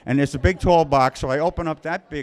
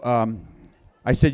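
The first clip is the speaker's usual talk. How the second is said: ordinary talk, nothing marked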